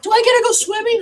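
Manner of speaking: put-on high-pitched little boy asking; eager kid. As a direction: ask like an eager child. Kid asks to swim.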